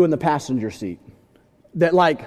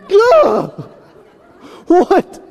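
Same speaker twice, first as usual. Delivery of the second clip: high, loud comic panic; hammed-up fright, then loud demand; startled yell; 12 semitones higher, 7 dB louder than usual. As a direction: jolt into an alarmed cry. Reenacts startled driver after wife's gasp.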